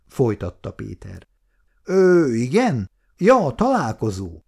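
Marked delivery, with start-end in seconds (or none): 1.8-4.4 hesitant, slowed stammer; faltering. Acted. Client caught off guard on the phone, faltering. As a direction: stumble over the words, caught off guard. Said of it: "rebegte a kagylóba az ügyfél".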